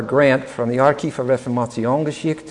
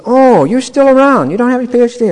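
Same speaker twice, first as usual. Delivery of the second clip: surprised mentor; 11 semitones higher, 7 dB louder than usual. Retold or imitated. Retired mentor surprised the student still lacks a PhD, high and loud.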